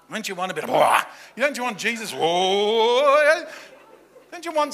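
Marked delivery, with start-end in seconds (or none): 0.7-1.0 a sudden loud wah for show; mock wah of spectacle. Preacher mocking the crowd's wish for spectacle, loud wah. 2.1-3.4 loud, higher hammed-up whoa; mock whoa of amazement. Preacher mocking expectations of flashy miracles, loud whoa.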